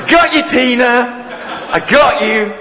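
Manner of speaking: high, slow, laughing teasing; playful laughing triumph; laughing gotcha. Retold or imitated. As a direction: tease the pupil through laughter. Teacher catching pupil out.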